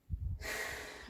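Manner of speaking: long breathy sigh; heavy sigh. Acted. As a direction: sigh before airing a complaint. Hesitant before airing a complaint.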